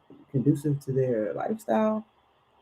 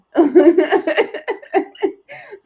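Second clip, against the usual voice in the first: big laugh; 12 semitones higher, 9 dB louder than usual. Real, not acted. Laughs at own accent.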